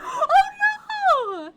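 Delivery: gasping high shout of alarm; laughing-crying oh no; shocked cry. Real, not acted. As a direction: yelp out in sudden shock. Sudden game shock.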